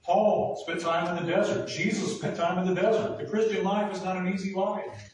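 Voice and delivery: full-force preaching. Acted. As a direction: drive the point home from the pulpit.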